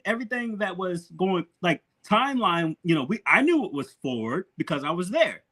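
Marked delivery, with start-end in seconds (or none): none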